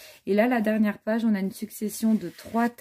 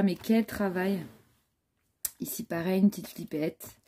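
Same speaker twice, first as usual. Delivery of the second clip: normal talking voice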